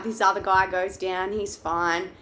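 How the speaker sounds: normal talking voice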